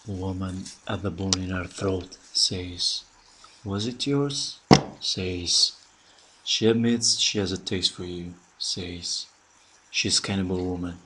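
slow, soft, hushed poetry reading; poem recitation. Acted. Radio host reads a poem excerpt on air, soft and slow. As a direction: let each line of the poem hang, slowly. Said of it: "Edmundo ha pronunciato un pezzo della poesia".

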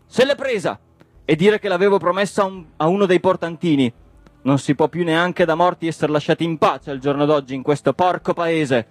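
worked-up, high-pitched harangue; indignant tirade. Acted. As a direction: rant in outraged exasperation. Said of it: "indignato sul muso".

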